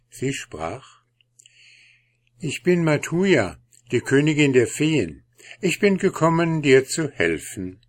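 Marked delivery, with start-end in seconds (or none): 2.4-7.8 high, gentle fairy queen. Acted. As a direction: speak as a kind fairy queen.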